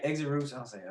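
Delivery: ordinary talk, nothing marked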